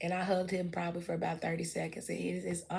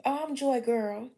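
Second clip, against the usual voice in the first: higher, upbeat chatty voice; to a stranger in the store, bubbly and higher; bubbly friendly intro; 6 semitones higher, 3 dB louder than usual. Pretend. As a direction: charm a new friend into a hug. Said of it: "introducing herself warmly".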